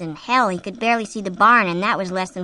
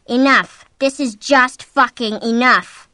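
loud, raised, emphatic outburst; fed-up anger; 4 semitones higher, 8 dB louder, slower than usual. A narrator's reading. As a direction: shout that you've had enough. Husband fed up with bombardment.

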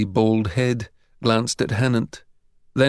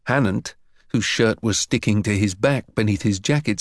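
usual speaking voice

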